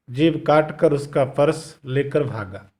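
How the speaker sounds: ordinary talk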